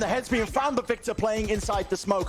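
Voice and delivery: rising, high hype play-by-play; esports caster. Acted. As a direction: build the call as the kills come. Caster calls multikill.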